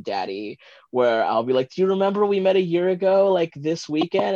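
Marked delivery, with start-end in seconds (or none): 1.7-4.3 eager. Retold or imitated. Eager question to partner.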